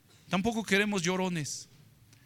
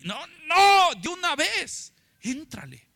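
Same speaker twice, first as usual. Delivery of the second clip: urging; 7 semitones higher, slower than usual. Real, not acted. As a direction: urge them to jump in right away. Preacher urging the congregation.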